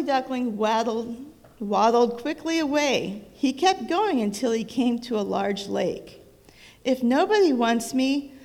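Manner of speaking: storybook reading voice, lively; reading to children. A narrator's reading. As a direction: read it aloud like a bedtime book. Reads picture book to child.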